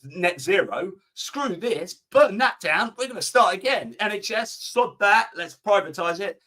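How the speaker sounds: high, agitated mock populist rant; Nigel Farage. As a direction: imitate a rabble-rousing politician, pitched high. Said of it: "Nigel Farage comes along and says".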